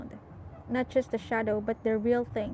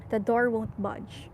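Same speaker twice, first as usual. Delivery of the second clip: usual speaking voice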